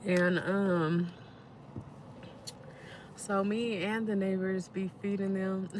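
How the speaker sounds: ordinary delivery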